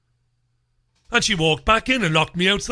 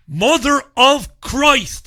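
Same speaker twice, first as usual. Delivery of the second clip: loud, high, drawn-out outburst; exasperated exclamation; 7 semitones higher, 5 dB louder, much slower than usual. Retold or imitated. Customer told to pay from the street, loud exasperation. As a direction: retell it as a slow, exasperated oath.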